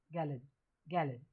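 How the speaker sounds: "गैलन" with the o reduced to a weak schwa; schwa in gallon. Shown as a demonstration. Teacher models the unstressed o for students. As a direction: say "गैलन" lightly, no full o sound. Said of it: "ओ को नहीं पढ़ना".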